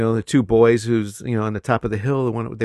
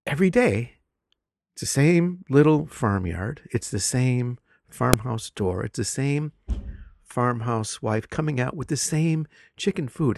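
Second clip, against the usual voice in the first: drawn-out, slow repeated same; slow storytelling; 3 dB quieter, slower than usual. Shown as a demonstration. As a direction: stretch each same like a fable. Teacher slowing for story effect.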